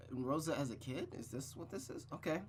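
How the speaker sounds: plain reading voice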